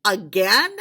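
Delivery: loud, sharp disbelieving bark; the chairman scolding the doctor, loud disbelief; incredulous shout. Acted.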